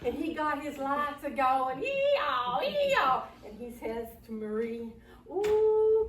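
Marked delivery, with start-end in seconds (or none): 1.8-3.2 high, two-note siren wail; imitated police siren. Storyteller imitates cruiser's siren. 5.3-6.1 high, loud singsong whoop; excited. Acted. Excited sheriff hollers to Marie.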